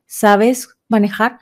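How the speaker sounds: ordinary delivery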